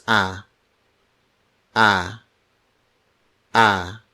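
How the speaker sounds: A said as an open "ah"; open vowel A. Shown as a demonstration. Teacher models the letter A for learners, open "ah". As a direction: say A very openly, as "ah". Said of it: "vowels are pronounced very openly".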